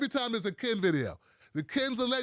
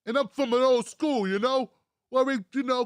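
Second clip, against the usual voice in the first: ordinary talk, nothing marked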